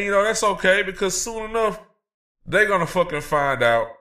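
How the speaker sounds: normal talking voice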